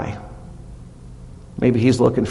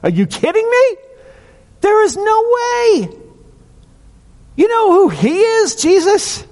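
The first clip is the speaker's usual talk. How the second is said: much higher, louder incredulous mock voice; outraged crowd; 3 dB louder than usual. Townspeople protesting Jesus's visit to the tax collector, high, loud outraged mock voice. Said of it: "they're like".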